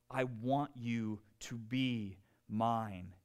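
slow, soft, tender plea; tender divine call. Acted. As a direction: speak slowly and softly as God calling someone close. Voices God calling to listeners.

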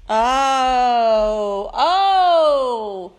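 ordinary talk

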